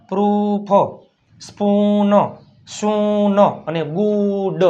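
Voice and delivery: each oo drawn out, as in "બુક"; word-by-word drill, stretching the vowel in "સૂન"; long oo. Shown as a demonstration. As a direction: hold the oo steady and long in "ફૂડ". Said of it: "જો ઉ, મોટો ઉ".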